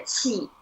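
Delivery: 气 with a clear downward fall. Shown as a demonstration. Teacher confirms the tone to student, 气 falling. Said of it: "Yes, the falling tone".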